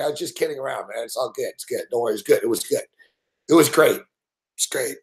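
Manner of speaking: calm him down gently; reassuring. Retold or imitated. Comforting the rattled co-host during the break, soft. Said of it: "I had to big him up".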